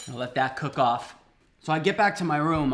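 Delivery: usual speaking voice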